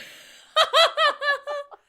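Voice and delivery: high squealing burst of laughter; loud high laugh; shrieking laugh. Real, not acted. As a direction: let out a shrill cackle.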